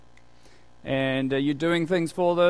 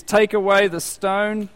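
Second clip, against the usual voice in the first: loud, higher, slow order; firm command; 6 semitones higher, 7 dB louder, slower than usual. Retold or imitated. Jesus upset at the tomb, firm loud command. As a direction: command it slowly and forcefully.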